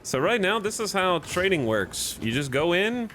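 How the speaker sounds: normal talking voice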